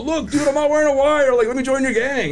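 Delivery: high mock yell of a desperate tweaker; mock shout. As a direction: yell it high and frantic, like he is proving himself. Client shouting he has no wire.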